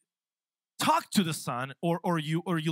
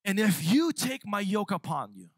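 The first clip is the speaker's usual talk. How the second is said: louder, slower impassioned sermon; a louder, impassioned preaching run with stretched phrases; building preaching; 2 semitones higher, 4 dB louder, slower than usual. Acted. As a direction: preach it with rising fervor.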